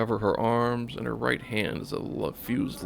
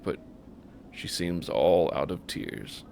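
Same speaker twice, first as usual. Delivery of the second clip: ordinary talk, nothing marked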